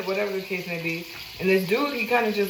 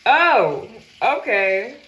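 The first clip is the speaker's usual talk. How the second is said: taken aback; 5 dB louder, much slower than usual. Retold or imitated. Replying to the man's club news, taken aback. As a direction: react with a loud, surprised oh.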